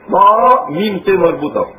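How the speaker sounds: usual speaking voice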